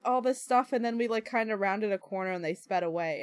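normal talking voice

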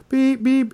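high squeaky beep beep; imitated censor beep. Kids imitating the cartoon dog's bleeped words. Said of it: "What did it say then?".

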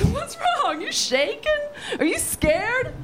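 high-pitched taunting questions; mocking, high imitation of dad; imitated deaf dad. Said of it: "And my dad's like".

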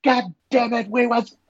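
high-pitched, hammed-up indignant yell, slow; imitating a furious man's outburst, way up in pitch; mock angry outburst. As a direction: boyfriend snapping back at the captain in a hammed-up, high, slow outburst. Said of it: "And the guy got mad".

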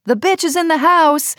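high, gleeful mock shout of the book title; gleeful yell. Bookstore employees greeting the author, gleeful high yell. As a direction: cheer it out like excited bookstore staff. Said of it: "gleefully yell".

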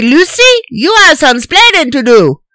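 mock Cuban accent; Ricky accent. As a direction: punch splaining in a thick Cuban accent. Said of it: "he doesn't say".

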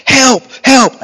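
high, loud, repeated cries of help; retold screams for help. Scared boy screaming to his sailing instructor, high and loud. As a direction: yell it like a scared kid in a storm. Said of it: "screaming to the instructor".

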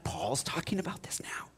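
hushed, startled whisper; surprised whisper. Retold or imitated. Listeners amazed that Paul raises the mysteries, whispering. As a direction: whisper it like gossip in the pews.

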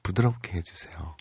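very soft, low request; hushed, intimate acted plea. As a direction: murmur it tenderly as the story's woman. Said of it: "얘기합니다".

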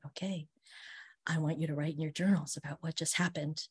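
quietly imitated teacher, soft and contained; lightly voiced teacher keeping composure; understated teacher voice. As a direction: give a hushed, measured classroom instruction. Teacher calms shocked class, retold.

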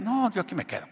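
high-pitched put-on refusal; acted refusal.

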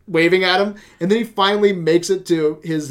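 ordinary talk, nothing marked

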